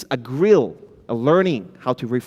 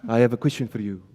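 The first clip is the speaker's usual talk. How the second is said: put-on a light French accent, lower pitch; 5 semitones lower, faster than usual. Speaker imitating a French investor asking a founder, light French accent. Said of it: "He talked like this".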